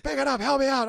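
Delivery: handcuffed actor begging co-star for help; urgent plea. Retold or imitated. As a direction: call out for help, half laughing. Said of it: "And I was like".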